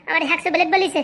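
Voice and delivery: ordinary talk, nothing marked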